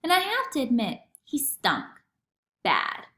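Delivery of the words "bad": ordinary delivery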